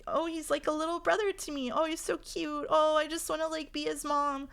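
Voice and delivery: mock the cutesy little-brother trope; high, syrupy mock gushing over a cute boy; mocking gushy voice. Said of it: "are literally like".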